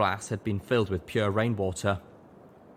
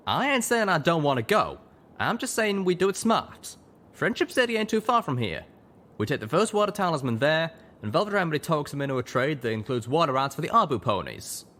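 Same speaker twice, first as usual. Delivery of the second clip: higher, louder drawling stallion; Calamity voiced; 8 semitones higher, 3 dB louder than usual. A narrator's reading. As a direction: sell the smart plan. Calamity planning.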